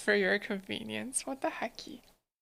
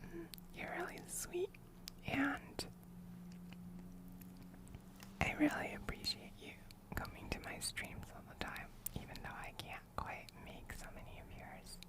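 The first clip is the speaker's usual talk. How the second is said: soft ASMR whisper close to the mic; ear whispering; 4 dB quieter than usual. Real, not acted. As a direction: breathe it right into their ear. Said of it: "somebody else redeemed whispering".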